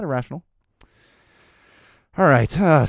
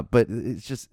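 ordinary talk

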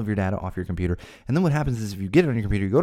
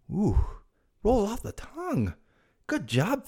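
much higher, slower mock-impressed voice; teasing beer name; mock delight; 13 semitones higher, slower than usual. Real, not acted. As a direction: savor the name sarcastically. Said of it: "mock praise of beer name".

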